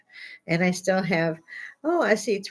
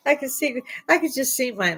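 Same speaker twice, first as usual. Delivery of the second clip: ordinary delivery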